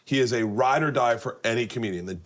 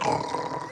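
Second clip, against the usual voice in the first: rough mock snoring; imitated snore; 4 dB quieter than usual. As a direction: snore like someone suddenly out cold. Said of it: "who just falls asleep like".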